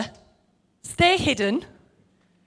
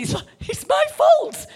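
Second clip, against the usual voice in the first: mocking whine. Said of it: "Riley, you know, and a few others".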